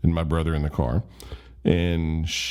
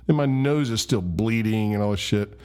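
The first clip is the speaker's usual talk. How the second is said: plain reading voice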